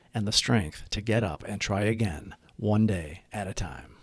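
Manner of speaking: calm, reverent Serenity Prayer. Acted. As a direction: recite it like a group prayer, steady and devout.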